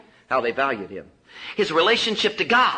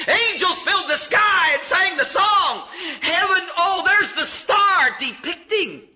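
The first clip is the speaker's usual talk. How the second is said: soaring preaching; 9 semitones higher, slower than usual. Real, not acted. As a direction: lift the congregation to the sky. Preacher rhapsodizing over the nativity.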